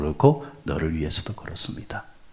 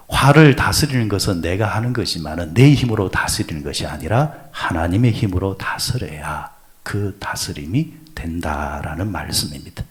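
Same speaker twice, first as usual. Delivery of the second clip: ordinary talk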